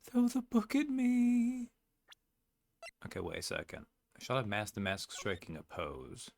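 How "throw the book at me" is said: high, loud, eager confession; high pleading criminal. Acted. Suspect begging the court to convict him, high-pitched eager plea. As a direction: beg to be found guilty, very high.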